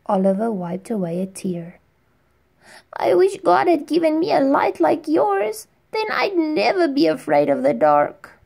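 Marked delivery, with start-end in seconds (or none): 2.9-8.4 much higher, louder, small ashamed voice; boy apologizing to his firefly friend, near tears; tearful little boy. Acted. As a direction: confess like a sorry, scared kid. Boy wishes for light.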